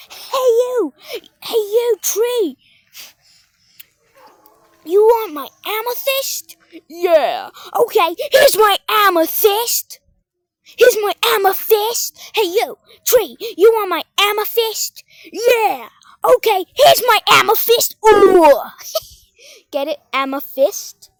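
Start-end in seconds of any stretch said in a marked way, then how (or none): 0.1-2.6 high, loud play-fight call; hammed-up tough shout; mock challenge. 4.8-18.0 loud, high play-fight threat; hammed-up tough challenge; mock taunting. 18.1-18.6 loud drawn-out battle noise; big pretend fighting whoop; play-fight yell.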